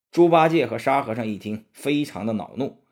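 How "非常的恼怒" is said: plain reading voice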